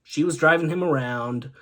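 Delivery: normal talking voice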